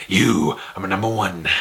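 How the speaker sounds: low villain impression; Jack Palance. Reviewer mimicking the film's villain, low impression. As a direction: drop the voice for a menacing movie boss. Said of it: "Jack Palance".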